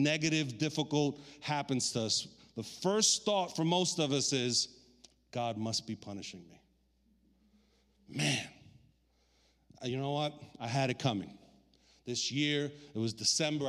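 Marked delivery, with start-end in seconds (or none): 5.3-11.4 resigned. Acted. Voices a sufferer's first thought.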